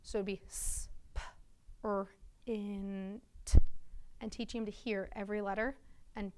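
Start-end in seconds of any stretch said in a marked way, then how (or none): 0.0-3.2 "sp-, er, int" sounded out slowly, piece by piece; tapping out sounds. Shown as a demonstration. Teacher showing students how to tap out a word. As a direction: split "sprint" into separate sounds, slowly. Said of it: "teach them to tap it out".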